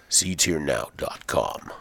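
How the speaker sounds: deep voice. Shown as a demonstration. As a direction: drop low and drag it out. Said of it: "Should I do the deep voice?".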